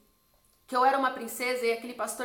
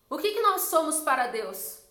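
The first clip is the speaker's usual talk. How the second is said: loud, raised pulpit question; preacher's question; 4 semitones higher, 6 dB louder than usual. Retold or imitated. Pastor questioning the church from the pulpit, preacher's loud question. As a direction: call it out to a whole congregation.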